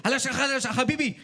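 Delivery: throaty put-on Arabic, hammed up; joking how to sound Arabic, mock Arabic accent. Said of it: "put a lot of phlegm and just say Habibi".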